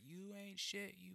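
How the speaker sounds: high, tight retold confrontation held back; hissed threat. Retelling telling off the roommate, high and seething. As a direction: seethe at him under your breath.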